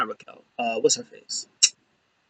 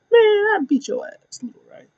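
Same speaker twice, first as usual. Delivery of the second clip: much higher mocking taunt; mocking Ray Ray. Wrestler taunting little Rey Mysterio, high mock sneer. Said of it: "He was like".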